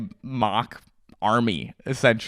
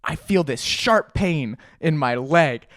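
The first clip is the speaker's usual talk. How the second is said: normal talking voice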